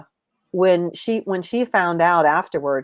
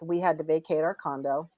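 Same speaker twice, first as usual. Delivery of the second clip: usual speaking voice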